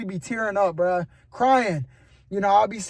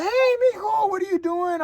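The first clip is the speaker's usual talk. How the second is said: high, sweet voice of a grandmother; grandma's voice; 10 semitones higher than usual. Retold or imitated. Grandma calling her grandson at work, in a high doting voice.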